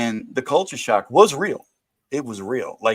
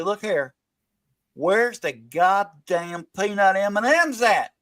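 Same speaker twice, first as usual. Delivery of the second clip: hillbilly voice; 7 semitones higher than usual. Retold or imitated. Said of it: "And you gotta say".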